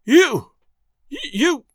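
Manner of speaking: high, outraged stammer; spluttering rage. A narrator's reading. Agent raging at Makola, spluttering. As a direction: choke on the word in anger.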